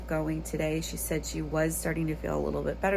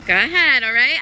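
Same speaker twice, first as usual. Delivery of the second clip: calling out; 8 semitones higher, 8 dB louder than usual. Real, not acted. Mom calling after her kids running to the bus, loud and high. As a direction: send them off with a high call.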